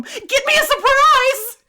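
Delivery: put-on high, drawn-out wailing child's shout; desperate needy child. Child calling after mom for a surprise, desperate. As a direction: plead loudly like a needy little kid, near laughter. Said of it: "desperate voice that, like, only a needy child can have".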